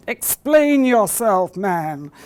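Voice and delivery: slow, loud demand from a Victorian boss; stern shipyard owner. Retold or imitated. Shipyard owner confronts the plumber over his bill, slow loud demand. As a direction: boom it out like an outraged employer.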